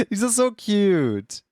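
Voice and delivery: high, slow adoring voice; gushing. Real, not acted. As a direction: coo over cute art. Gushing over emotes.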